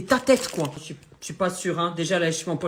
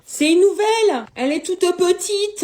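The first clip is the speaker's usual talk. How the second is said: cooing over a doll; 10 semitones higher, 6 dB louder than usual. Real, not acted. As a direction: coo at the little toy, slowly.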